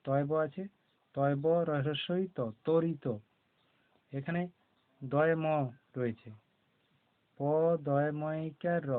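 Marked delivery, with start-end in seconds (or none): 2.7-3.0 তরিত with a firm ত and no ব sound; stressed ত, silent ব. Shown as a demonstration. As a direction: lean on the ত in তরিত, leaving ব silent. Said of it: "তটা জোর দিয়ে বলা হয়".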